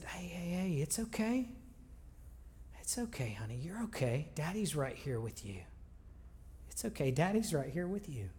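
put-on soft, gentle hush to a crying child; calm a little girl back to sleep; father soothing his crying daughter, softly; soothing hushed comfort.

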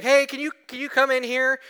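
high, loud call for help from the floor. Retold or imitated. Pastor on the office floor calling a coworker, loud call. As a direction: call out to the other guy down the hall.

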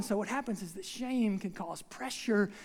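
ordinary talk, nothing marked

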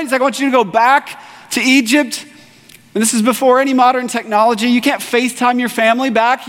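high, ringing preacher voice; preaching at full force. Acted. Pastor to his church, preaching at full force. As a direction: drive the story home to the congregation.

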